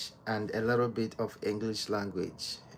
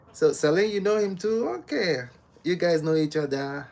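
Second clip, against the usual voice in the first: higher and louder in amazement; surprised, rising and loud; 6 semitones higher, 7 dB louder than usual. Real, not acted. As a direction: discover they know each other. Surprised two viewers know each other.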